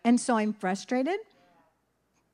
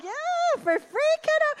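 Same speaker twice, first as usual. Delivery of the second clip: much higher, playful and slow. Retold or imitated. Nana humoring her grandson in a high playful voice. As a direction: humor the kid in a sing-song voice. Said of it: "so I'm like".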